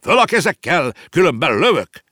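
high, loud shouted command; roared threat. Acted. Uncle aiming his cane at the whale, yelling. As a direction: bellow it as a hold-up. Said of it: "ráordított".

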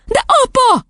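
put-on very high little-girl plea; high-pitched childish protest. A narrator's reading. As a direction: object in an upset child's squeal.

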